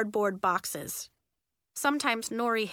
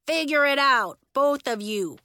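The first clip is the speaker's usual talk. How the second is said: loud, fed-up order; exasperated raised voice; yelling; 4 semitones higher, 6 dB louder than usual. A narrator's reading. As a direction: yell it and drop at the end. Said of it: "yelled Elliot".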